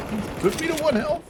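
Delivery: can't believe what the bot just did; outraged disbelief. Real, not acted. Gamer scolding a bot that left him at one health, outraged high disbelief.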